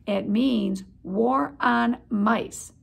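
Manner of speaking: slow, dark pronouncement; ominous. Acted. Aunt Dorothy warning the family, ominous and slow. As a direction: drag out each word like doom. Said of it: "said Aunt Dorothy ominously".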